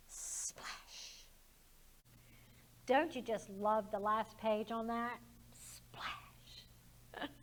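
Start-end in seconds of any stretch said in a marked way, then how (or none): 0.1-1.3 breathy whispered splash; soft whisper payoff. Acted. Story's quiet punchline word. 5.5-7.3 breathy whispered splash; soft whisper payoff. Acted. Repeats the last page.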